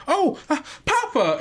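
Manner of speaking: put-on high, bright little girl's "Papa!"; a little girl who has just heard her dad pull up; excited child cry. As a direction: jump up with a child's delight. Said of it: "and it's like".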